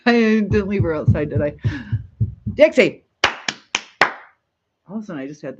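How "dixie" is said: loud, high call of "Dixie"; calling the dog. Real, not acted. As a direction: call the dog from another room, louder and higher. Calling her dog from the room.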